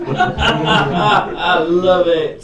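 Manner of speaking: high squealing laugh. Real, not acted. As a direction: laugh out the words in delight. Delighted laughing reaction.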